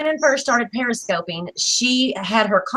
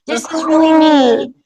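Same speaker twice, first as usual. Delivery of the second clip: imitating a nervous girl, higher and louder; mock-frightened first-timer showing her face; hammed-up scared voice rising higher; 6 semitones higher, 5 dB louder than usual. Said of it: "and she's so scared".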